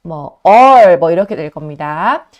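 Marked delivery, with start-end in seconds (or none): 0.4-1.0 "얼"의 모음을 오와 어 중간으로 크고 길게. Shown as a demonstration. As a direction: say "얼" loud, vowel halfway between o and eo. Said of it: "실제 발음은 오와 어 사이에 있어요". (영어 all처럼 "얼"을 오와 어 사이 소리로)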